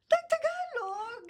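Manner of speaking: high drawn-out wail; long, high-pitched playful yelp; playful cry. Real, not acted. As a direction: sing out the reminder in mock alarm. Playful interjection to switch language.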